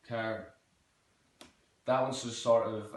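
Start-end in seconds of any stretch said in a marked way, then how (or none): 0.1-0.5 "Car" with sharpened final R; sharp Irish R ending "Car"; Southern Irish pronunciation. Shown as a demonstration. Teacher demonstrating Southern Irish, "Car" with sharp R. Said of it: "sharpening the R at the end".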